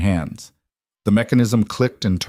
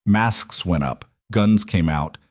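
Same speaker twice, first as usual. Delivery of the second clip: plain reading voice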